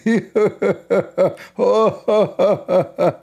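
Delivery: giggling high and long; high laugh. Real, not acted. Laughs at the hadith.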